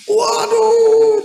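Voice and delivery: long, high, loud wail; retold cry of horror. Rider seeing his dragged grandmother, retold horrified cry. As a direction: cry out as if reliving the shock. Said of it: "saya teriak".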